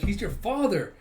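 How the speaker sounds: loud, high retold exclamation; dramatic reveal. Retelling a story's big reveal, mock-dramatic exclamation.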